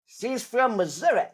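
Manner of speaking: imitating grandma's Missouri accent. Imitating how grandma sounds from Missouri. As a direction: put on grandma's Missouri twang. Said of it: "That's how my grandmother sounds".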